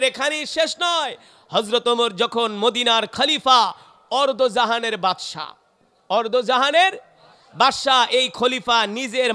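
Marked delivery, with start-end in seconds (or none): none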